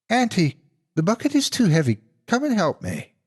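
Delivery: put-on high, urgent child's voice; scared boy calling. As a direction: call out like a frightened little boy. Boy calls for help.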